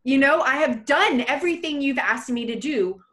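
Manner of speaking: high, loud desperate cry to God; woman screaming to God for a child, high and loud; retold scream to God. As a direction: shout it up at heaven, pleading. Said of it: "I screamed to God".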